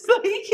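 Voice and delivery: high, loud laugh breaking out; high burst of laughter. Real, not acted. Laughing at her own weird stalker stories.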